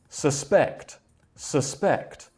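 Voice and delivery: "suspect" as a verb, su-SPECT; verb stress on second syllable. Shown as a demonstration. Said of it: "the stress for the verb is on the second syllable".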